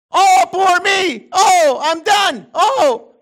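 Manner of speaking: high, loud, slow self-pitying moan; whiny complainer. Pretend. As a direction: wallow in mock self-pity. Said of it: "When you start grumbling".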